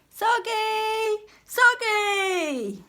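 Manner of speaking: high, loud call across the water. Acted. Jack calling to Soggy from the boat, high loud call. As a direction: call out to him from far away.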